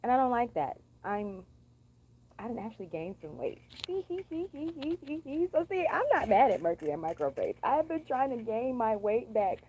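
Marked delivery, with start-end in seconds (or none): 3.8-5.5 high breathy giggle; light giggle; giggling. Real, not acted. Laughing at weight gain.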